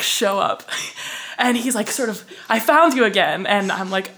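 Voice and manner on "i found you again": loud retold announcement; excited announcement. Man finds her on another platform, excited announcement. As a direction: proclaim it like a lucky find.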